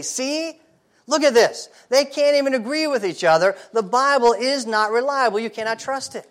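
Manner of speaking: high, sneering scoffer voice; mocking skeptic. Skeptic scoffing at the gospels, high and mocking. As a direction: jeer like a doubter waving the Bible off. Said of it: "a scoffer and a skeptic will take a story like this and say".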